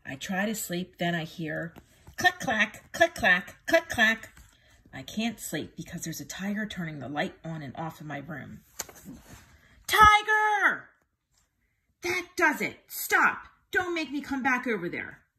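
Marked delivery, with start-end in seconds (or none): 2.2-4.3 louder click-clack sound words; clicking sound effect. A narrator's reading. Narrator mimicking the light switch, louder. 9.9-15.3 much higher, louder angry yell; angry shout. A narrator's reading. Child yelling at the tiger to stop, angry shout.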